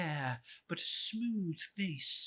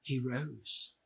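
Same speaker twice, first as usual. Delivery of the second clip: plain reading voice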